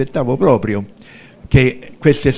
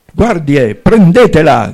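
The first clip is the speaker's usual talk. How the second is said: vizier calling the guards, getting louder; shouted command; 4 semitones higher, 7 dB louder, slower than usual. Acted. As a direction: cry out an order to seize her. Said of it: "grida il visir". (ordine gridato)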